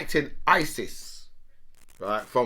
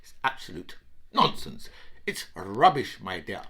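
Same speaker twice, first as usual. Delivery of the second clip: slow, softer, haughty posh voice; mock posh dismissal; 5 semitones lower, 6 dB quieter, slower than usual. Comic mocking British and Americans dismissing Putin, posh. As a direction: brush it off like a snooty diplomat. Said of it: "the British and the Americans say".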